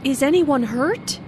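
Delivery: anxious, rising question; worried question. Acted. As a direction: ask it with concern. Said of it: "asked in a worried way".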